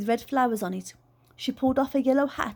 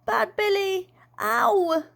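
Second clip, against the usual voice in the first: fake sick child; 9 semitones higher, much slower than usual. Child faking a bellyache.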